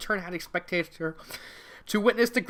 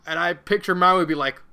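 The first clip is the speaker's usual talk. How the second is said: ordinary delivery, nothing marked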